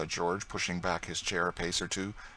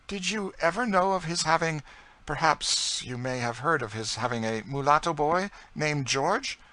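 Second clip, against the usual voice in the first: high-pitched, louder lady with keen interest; raised pitch, intent questioning; probing French lady; 9 semitones higher, 5 dB louder than usual. A narrator's reading. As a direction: probe hesitantly in a high lady's voice.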